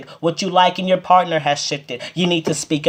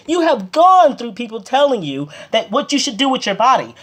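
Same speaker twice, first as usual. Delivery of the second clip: heated; 8 semitones higher than usual. Real, not acted. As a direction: let the frustration boil over, pitched up. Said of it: "I'm sorry I'm a little heated with this".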